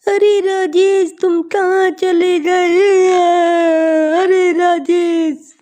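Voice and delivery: tearful lament. Acted. Girl weeping where her lover died, plaintive wail. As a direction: call the lost lover in acted grief. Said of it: "वहाँ बैठकर रोने लगती है".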